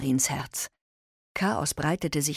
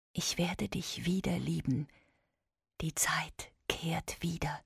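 soft tender whisper; 6 dB quieter than usual. Acted. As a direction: whisper it into her ear. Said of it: "Und so flüsterte sie ihr ins Ohr".

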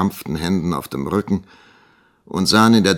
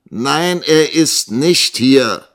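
slow, raised, indignant denial; offended; 9 semitones higher, 8 dB louder, much slower than usual. A narrator's reading. Young teacher accused of lying by the schoolmaster, offended. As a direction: insist loudly with wounded pride. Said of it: "erwiderte Nikolas gekränkt".

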